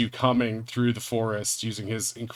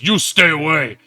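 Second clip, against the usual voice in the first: loud, higher ape warning; 4 semitones higher, 8 dB louder than usual. Acted. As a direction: bark it at intruders like a giant ape. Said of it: "and the ape says".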